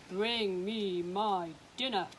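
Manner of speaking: slow, loud giant demand. Acted. Giant ordering his wife to bring dinner, booming. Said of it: "he said".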